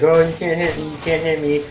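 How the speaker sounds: mock taunting kid. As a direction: imitate a kid teasing on the dodgeball court.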